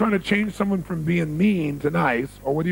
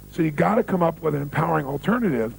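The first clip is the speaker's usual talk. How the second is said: normal talking voice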